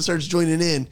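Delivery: ordinary delivery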